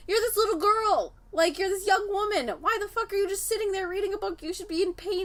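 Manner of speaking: high, incredulous voice; mock outraged disbelief; imitating the artist. Artist scolding her for feeling no pain, high and incredulous.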